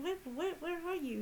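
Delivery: high, pleading where are you; high imploring cry to Sister Teresa; desperate calling. Retold or imitated. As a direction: call out to a guide who is gone. Calling out to absent spirit guide.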